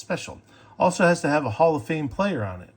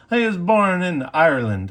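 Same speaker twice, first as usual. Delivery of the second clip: a clumsy attempt at an Irish accent, higher and louder; lightly put-on Irish lilt he then mocks himself for; bad Irish accent; 6 semitones higher, 6 dB louder than usual. Telling viewers about Archer's birthplace in a bad Irish accent. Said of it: "That's a horrible accent".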